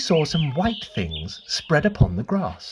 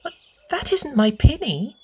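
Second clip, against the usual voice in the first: a hint of a curious little girl, higher; lightly voiced little girl; 11 semitones higher than usual. A narrator's reading. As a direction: ask like a small girl, wide-eyed. Lucy voiced in story.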